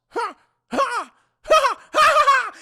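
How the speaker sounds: booming villain laugh, high and loud; Eon. Acted. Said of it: "He starts laughing at his big dumb ears".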